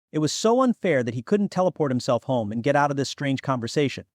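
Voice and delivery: plain reading voice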